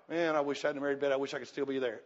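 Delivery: low, soft, quick mock-wistful aside; mock regret. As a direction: grumble a pretend regret under your breath. Voices a regret he never has.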